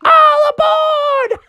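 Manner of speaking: very high, slow conductor shout; conductor call. Retold or imitated. Mom as conductor calling aboard, shouted high.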